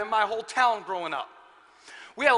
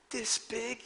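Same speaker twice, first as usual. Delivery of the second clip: put-on trembling child; 2 semitones higher, 5 dB quieter than usual. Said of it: "He's, like, shaking and trembling".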